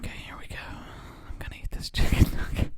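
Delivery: breathy close-mic whisper; ASMR whisper. Acted. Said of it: "I'm gonna be quiet so that they can get this full A S M R action".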